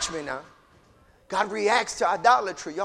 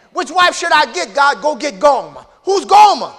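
loud, high back-and-forth dialogue; acted heated exchange; 7 semitones higher, 11 dB louder than usual. Hosea and God arguing over a wife, loud and high. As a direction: preach the exchange at high volume.

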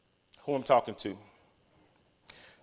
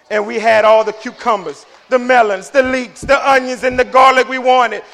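high, loud preacher delivery; full-force preaching; 8 semitones higher, 10 dB louder than usual. Real, not acted. Preacher scolds people who keep looking back, full-force preaching. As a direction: drive it out to the whole congregation.

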